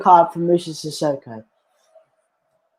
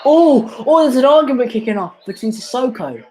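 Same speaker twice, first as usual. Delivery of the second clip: excited; 8 semitones higher, 3 dB louder than usual. Real, not acted. Reacting as a fight kicks off.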